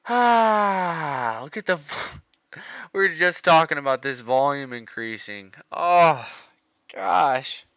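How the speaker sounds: high, loud excited exclamations with laughter; thrilled shout at a tenfold move. Real, not acted. Trader excited as volume surges on the chart.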